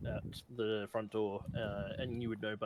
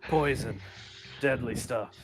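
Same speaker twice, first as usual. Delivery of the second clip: gruff officer; 3 semitones higher, 10 dB louder, slower than usual. Acted. Officer NPC voiced by GM.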